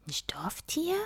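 soft, puzzled murmur. A narrator's reading. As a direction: murmur the question half to yourself. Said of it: "murmelte Sten". (leise murmelnd)

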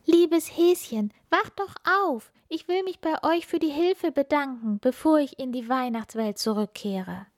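put-on high, tender child voice; little star child. Funkila waking the fainted bunny, high and gentle.

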